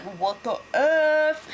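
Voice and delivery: slow, stretched incredulous what the F; slow mock shock at the betrayal; drawn-out disbelief. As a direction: drag it out like you can't believe it. [name] reacting in disbelief.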